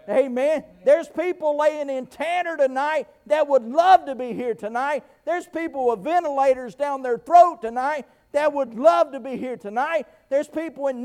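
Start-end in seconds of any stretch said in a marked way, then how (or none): none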